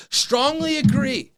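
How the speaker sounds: high, slow, loud declaration; emphatic. Acted. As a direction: declare it grandly. Exaggerated answer to a quiz item.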